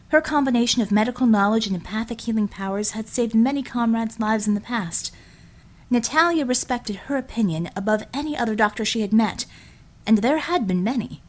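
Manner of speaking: usual speaking voice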